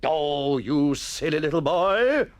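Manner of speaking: slow, exasperated scolding; storming. A narrator's reading. Master scolding a pupil for interrupting, storming. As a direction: lose patience with a pupil. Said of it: "the master stormed".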